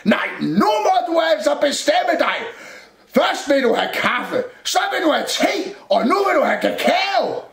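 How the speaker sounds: high, loud scolding cupboard; exasperated shout. A narrator's reading. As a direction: holler the complaint, item by item. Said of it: "råbte skabet". (råber opgivende og skældende)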